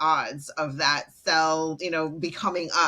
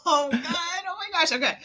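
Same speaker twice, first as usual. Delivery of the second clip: excited; 13 semitones higher than usual. Real, not acted. Excited reaction to question.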